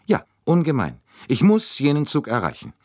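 high, urgent insistence; agitated female voice; anxious. A narrator's reading. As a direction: insist anxiously, pitched well up. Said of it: "Ihre Angst war jedoch überflüssig".

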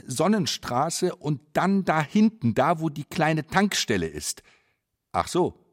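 usual speaking voice

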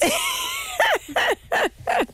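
very high, shrieking burst of laughter. Real, not acted. Trainer laughs after Udo story. (kreischendes Lachen)

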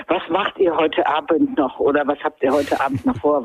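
low-pitched mock version of a cloned voice; imitating unnatural speech. Mother quoting the clone's unusual way of speaking, imitating. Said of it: "das ist halt nicht deine Art zu sprechen".

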